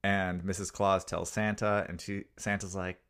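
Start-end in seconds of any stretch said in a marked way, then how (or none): none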